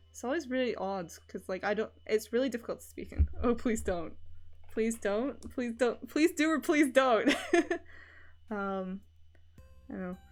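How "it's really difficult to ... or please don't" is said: ordinary talk, nothing marked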